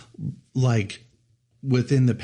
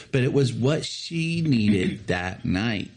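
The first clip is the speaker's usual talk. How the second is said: ordinary delivery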